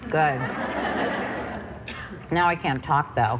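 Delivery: talking with mouth full. Real, not acted. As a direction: talk through a mouthful of cupcake. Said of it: "Sorry, I'm trying to talk with my mouth full".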